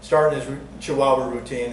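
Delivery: ordinary delivery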